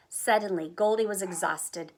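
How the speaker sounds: ordinary delivery, nothing marked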